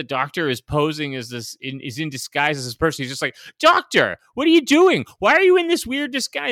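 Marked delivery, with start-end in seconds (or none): 3.6-6.5 high, loud, alarmed blurting; mock outburst. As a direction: blurt it out like a clueless sidekick. Mocking Steven's clueless outburst.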